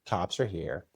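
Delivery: mock a very drunk, careless announcement; no care in the world; drunk nonchalance. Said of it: "Nonchalantly, very drunk".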